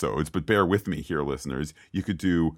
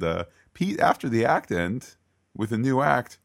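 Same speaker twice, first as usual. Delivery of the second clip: ordinary delivery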